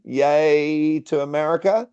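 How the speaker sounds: drawn-out, playful patriotic yay; mock cheer. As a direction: stretch out a half-serious little cheer. Playful cheer for adopted country.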